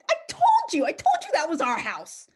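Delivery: high, loud, gleeful I-told-you; triumphant. Retold or imitated. Wife proved right to her husband, triumphant. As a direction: crow it, pitch shooting up.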